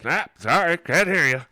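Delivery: high, loud gargoyle voice; deaf gargoyle. Retold or imitated. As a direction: play a hard-of-hearing door knocker. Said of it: "kinda like from Labyrinth".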